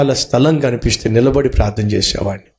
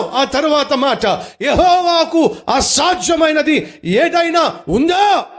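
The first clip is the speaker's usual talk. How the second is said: high, ringing sermon voice pressing the question; preaching at full force; 12 semitones higher than usual. Acted. Pastor pressing the congregation, preaching at full force.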